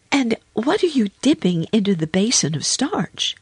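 put-on high, inquisitive girl voice; curious little girl. A narrator's reading. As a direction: ask with high-pitched childlike curiosity. Lucy asks about the laundry.

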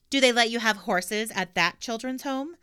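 lightly voiced cheeky girl. Pippi voiced in story.

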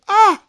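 high, loud "Ah!" of outrage; indignant cry. Real, not acted. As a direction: yelp in scandalized protest. Outraged at her claim.

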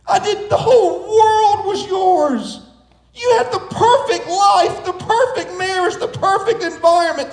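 loud, high heartbroken cry of no; anguished outcry. Retold or imitated. God mourns the children who hid, a loud high anguished cry. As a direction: plead at full voice, grieving what was lost. God grieving over Adam's fall.

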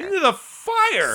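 slow, high disbelieving question; shocked. Real, not acted. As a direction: gasp it out in shock. Shocked echo of fire fact.

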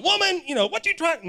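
mock rude. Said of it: "that's not how Jesus was".

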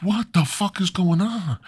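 high-pitched disbelief; baffled Adam. Acted. Adam reacts to Eve's chanting, high and bewildered.

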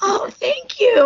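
high cracking broken voice; squeaky croak. Retold or imitated. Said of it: "And I was like".

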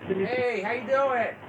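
faint, distant phone voice; far from mic. Real, not acted. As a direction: sounds far away from the phone. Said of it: "your voice is really faint".

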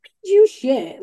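retorting to son. Retold or imitated. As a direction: snap back at the kid's excuse. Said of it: "I'm like".

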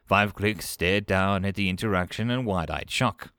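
usual speaking voice

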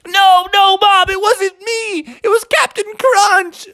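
high, whining protest; Froggy. Acted. Boy denying it to his mom, pleading. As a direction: plead innocence in a wailing kid voice. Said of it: "And Froggy's like".

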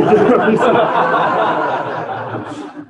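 a real burst of laughter; hearty laugh. Real, not acted. Audience gives right answer.

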